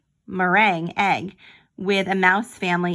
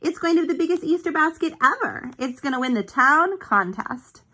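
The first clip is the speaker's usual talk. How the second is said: higher, faster eager boast; proud boast; 7 semitones higher than usual. Acted. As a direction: brag with a big grin. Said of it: "Desmond smiled".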